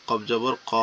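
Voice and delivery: usual speaking voice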